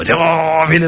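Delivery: രാവിലെ with a long drawn-out first vowel; early-morning stretch on രാവിലെ; stretched word. Shown as a demonstration. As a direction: hold the first syllable of രാവിലെ. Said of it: "മാഷക്ക് എഴുതി പഠിപ്പിക്കാനാവോ".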